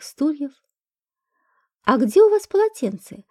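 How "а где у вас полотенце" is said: lightly voiced woman, higher pitch; unfamiliar woman's voice. A narrator's reading. A guest asking the host for a towel, lightly voiced as a woman. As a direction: lift the pitch a little for a woman's question. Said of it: "спросил незнакомый женский голос".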